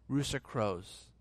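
normal talking voice

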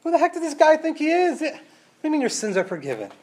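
high, outraged religious leaders; indignant scribes. Retold or imitated. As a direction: scoff at the nerve of this man. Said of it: "They say".

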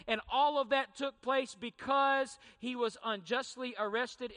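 loud, ringing sermon delivery; full-voiced preacher building to a peak; forceful preaching. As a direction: preach it out to the whole hall.

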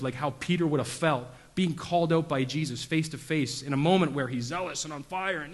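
usual speaking voice

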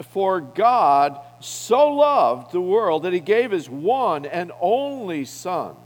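slow, weighty scripture reading; measured, reverent verse read aloud. Acted. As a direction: lean on loved, read it slowly. Reading John 3:16 slowly, emphatic.

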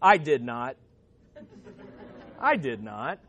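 a low, curt denial from down the hall; understated deadpan contradiction; voice from another cell. Acted. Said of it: "a voice erupted from another cell".

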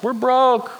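high-pitched mock wail, easing down; mock rooftop cry. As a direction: lightly cry it out as if to the whole town. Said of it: "like, screaming from the rooftops".

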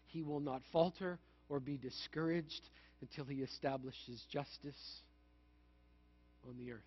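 soft, measured Bible reading; scripture reading. Acted. Pastor reads Isaiah to a discouraged congregation, gentle and measured. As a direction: read it gently, as comfort.

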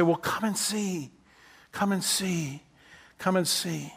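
soft, slow "come and see", repeated calmly; softly repeated calm invitation; gentle invitation. Shown as a demonstration. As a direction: invite them in quietly. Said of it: "my reaction ought to be".

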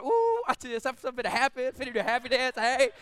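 hammed-up celebration, ready to dance; giddy Mary. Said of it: "she's finna get excited".

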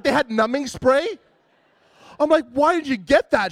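can't believe she had numbing spray; indignant disbelief. Retold or imitated. Retold outrage at wife.

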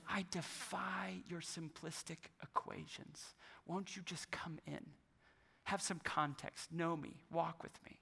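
very soft, hushed voice of God; gentle divine invitation. Acted. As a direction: quietly invite them closer.

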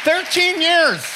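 high, loud, drawn-out cry; emphatic outburst. Real, not acted. Preacher on years his marriage took, loud outburst. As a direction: shout it out slowly, way up high. Crying out to the congregation.

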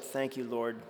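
low, soft, humble thank-you; softly and lowly humble, a mock version of the reply; mock meek gratitude. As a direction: pretend Peter as a grateful, meek disciple. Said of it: "No, that's not what Peter said".

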